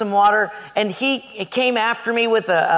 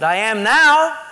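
louder, rising exasperation; irritated reply; 3 semitones higher, 6 dB louder, slower than usual. Retold or imitated. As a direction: answer as if rudely woken. Retold irritated reply when woken.

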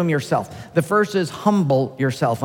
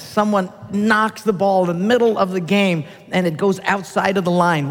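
ordinary delivery

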